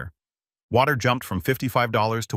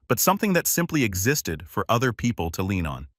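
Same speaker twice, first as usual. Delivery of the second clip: plain reading voice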